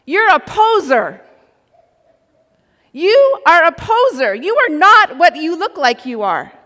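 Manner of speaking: loud, high accusing shout; full-voiced outburst, punching the words; yelling. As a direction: yell it as an outraged call-out. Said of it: "I yelled".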